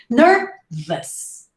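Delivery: "nervous" slowly split as ner... vus; smiled schwa. Shown as a demonstration. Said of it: "smile when you say the sound".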